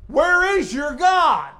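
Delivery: high, loud, slow demand; loud challenge. Acted. Preacher as Elijah confronting Baal's prophets, loud challenge. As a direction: ring out the taunt, drawing out each word.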